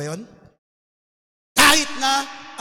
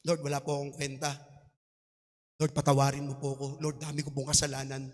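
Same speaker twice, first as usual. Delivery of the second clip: soft, low, contrite plea to the Lord; humble tax collector's prayer; 9 semitones lower, 7 dB quieter than usual. Retold or imitated. Sinner confessing to God, soft and humble. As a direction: beg forgiveness quietly, head bowed. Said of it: "sabi ng tax collector naman".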